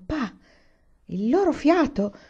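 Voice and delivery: appalled, pitched well up; horrified. A narrator's reading. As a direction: realise it with horror, voice rising. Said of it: "Era orripilata".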